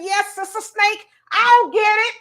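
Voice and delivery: acted-out confident rescuer, rising in volume; eager volunteer. Man under the car offering to get the snake, eager.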